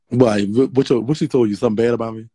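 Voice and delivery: ordinary talk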